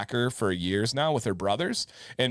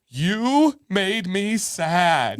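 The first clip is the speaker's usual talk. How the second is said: high, drawn-out mock upset; slow, raised-pitch whine of a hurt little boy; imitating crying kid; 6 semitones higher, 3 dB louder, much slower than usual. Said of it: "like, crying. And he was like".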